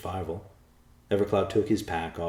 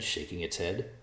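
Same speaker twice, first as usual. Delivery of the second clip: plain reading voice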